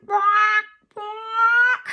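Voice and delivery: high, loud quacking; duck quack. Shown as a demonstration. Teacher doing duck sounds for kids, high loud quacks. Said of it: "That's a funny sound".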